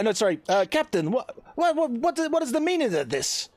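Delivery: high-pitched, stammering disbelief; shocked protest. Acted. Lord Marion questioning the captain, in shocked protest.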